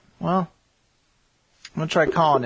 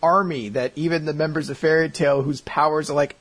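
normal talking voice

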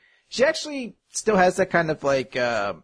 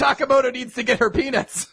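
mock announcement; 9 semitones higher than usual. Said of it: "I really don't want it to be like".

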